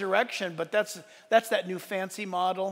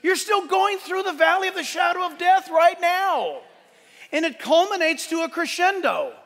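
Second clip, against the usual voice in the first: raised, ringing preacher's voice; preaching at full force; 6 semitones higher than usual. Acted. Preaching builds to a climax.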